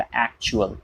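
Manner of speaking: "एक्चुअल" as ak-chu-al; model actual. Shown as a demonstration. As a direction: say actual clearly. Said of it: "ये इस तरीके से बोला जाएगा". ("एक्चुअल" साफ़ उच्चारण)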